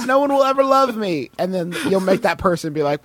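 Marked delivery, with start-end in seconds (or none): 0.0-1.3 mock despair. Acts the despairing inverted-nipple owner.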